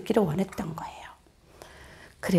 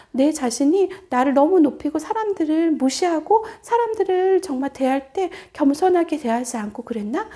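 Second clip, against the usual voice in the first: ordinary delivery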